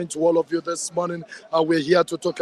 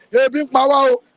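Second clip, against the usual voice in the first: imitating a crowd shouting, louder and higher; mock crowd shout; 7 semitones higher, 7 dB louder than usual. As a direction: play the hungry crowd yelling their complaint. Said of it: "Shout and scream".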